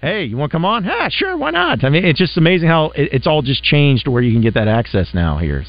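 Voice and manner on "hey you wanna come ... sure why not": high-pitched, lightly acted quote; mock casual exchange. Host imitating a student athlete agreeing to come on, mock casual. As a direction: toss off an easygoing invite and a high-voiced yes. Acts out easy invitation and acceptance.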